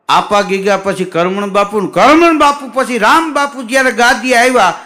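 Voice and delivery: ordinary talk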